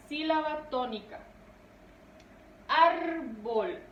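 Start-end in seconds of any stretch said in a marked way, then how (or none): 2.7-3.8 "árbol" in two syllables, first one stretched; stressed first syllable. Shown as a demonstration. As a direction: lean hard on the ÁR of "árbol". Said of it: "recae ahí la mayor fuerza de voz".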